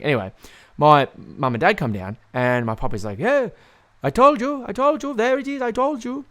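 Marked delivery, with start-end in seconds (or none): 3.2-6.2 high, crowing grandpa impression; imitating an old man's gleeful told-you-so; boasting old pop. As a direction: show off triumphantly in a high put-on voice.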